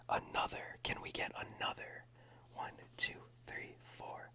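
soft close whisper throughout; ASMR whisper. Real, not acted. ASMR host whispering through a pack opening. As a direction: whisper it all for the ASMR channel.

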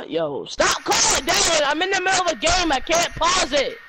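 high annoyed shout at Dad; annoyed yell. Real, not acted. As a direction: yell over your shoulder, irritated. Yelling at dad mid-game.

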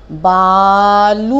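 बालू slow and loud, ऊ held; long ऊ. Shown as a demonstration. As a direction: model बालू slowly. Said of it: "बालू रेत होती है".

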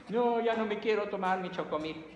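put-on high whiny child's voice; whiny crying kid. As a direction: whine like a crying eight-year-old. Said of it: "el niño empieza a llorar". (niño llorón con voz aguda)

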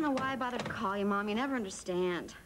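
low, falling, defeated complaint; resigned complaint. Acted. Daughter giving up on her mother by phone, resigned. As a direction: let "never understand" sink low in resignation.

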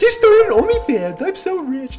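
high, drawn-out mocking girl voice; sing-song parody of a bragging girl; mock girly voice. Mocking a bragging streamer in a high girly voice.

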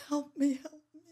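loud crying plea; desperate plea. Retold or imitated. Begging at the friend's door, loud and crying. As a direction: cry for help.

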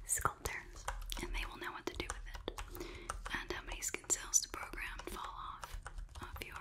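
soft, hushed whisper; confiding clinician's whisper; whispered ASMR role-play. Pretend. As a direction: drop right down to a quick whisper.

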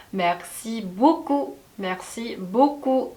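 맥시 보꾸 said slowly and softly; careful soft demo of 맥시 보꾸; slow model pronunciation. Shown as a demonstration. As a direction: say 맥시 보꾸 slowly for learners. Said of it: "그 다음 표현 볼게요".

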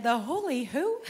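comic rising disbelief; puzzled, punchy retold question; baffled question. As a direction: play the clueless kid, voice rising on who. Baffled child's punchline to audience.

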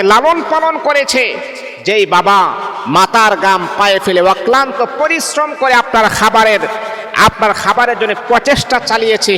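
high, loud, ringing preaching rebuke; impassioned shouted waz; shouted sermon rebuke. Acted. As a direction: thunder at the children who strike their parents. Impassioned rebuke of abusive children.

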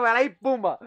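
ordinary talk

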